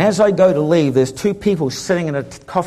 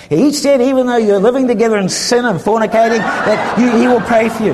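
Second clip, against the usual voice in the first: mock teasing; 7 semitones higher, 4 dB louder than usual. Friend teasing, raised voice.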